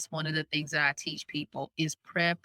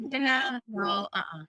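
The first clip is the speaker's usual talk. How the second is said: playful refusal; 3 semitones higher, slower than usual. Retold or imitated. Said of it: "Mine is".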